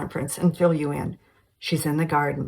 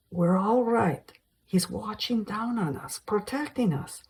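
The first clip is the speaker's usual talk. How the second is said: reassuring; 5 semitones higher, 4 dB quieter than usual. A narrator's reading. Susan reassures confused mother.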